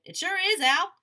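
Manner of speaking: loud, high holler. A narrator's reading. Boy tests the bullhorn on Al, hollering at full blast. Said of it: "I hollered into it".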